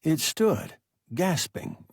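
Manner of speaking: ordinary delivery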